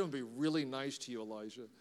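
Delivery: low, hushed murmur sinking away; fawning aside. Acted. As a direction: play it under your breath, sweet-talking a revered guest. Acted fawning aside to Elijah.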